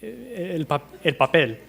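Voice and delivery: "el papel" with crisp p's and no puff of air; unaspirated p. Shown as a demonstration. Teacher shows "papel" without moving the paper. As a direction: hold the breath back on the p's of "el papel". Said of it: "necesitan decir papel sin mover el pi".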